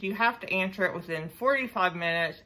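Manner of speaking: ordinary delivery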